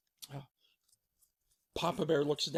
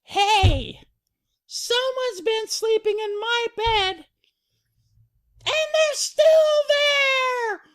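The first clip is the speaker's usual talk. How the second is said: squeaky cub building to a piercing shout; very high, loud, indignant falsetto; Baby Bear alarmed; 9 dB louder, slower than usual. Acted. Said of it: "Baby Bear goes".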